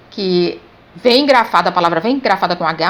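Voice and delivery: normal talking voice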